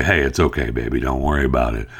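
put-on gently calm a scared child; soothing dad. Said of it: "the dad to come over and say".

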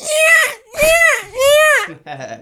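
high screeching seagull cry; screechy seagull voice. Retold or imitated. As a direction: shriek it out like a gull. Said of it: "[name] does a good seagull impression".

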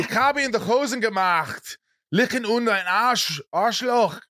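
very high mock German rant; exasperated German cursing. Acted frustrated cursing in German.

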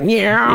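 high, broken, out-of-tune meow; geeky meow. Retold or imitated. Friend imitating John's geeky meow. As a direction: squeak out a nerdy cat noise. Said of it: "makes this so geeky noise".

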